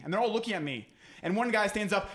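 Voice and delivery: usual speaking voice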